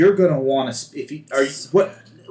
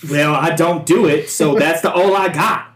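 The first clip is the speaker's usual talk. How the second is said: gunner role voice; 4 semitones higher, 6 dB louder than usual. Acted. Gunner shrugs off question.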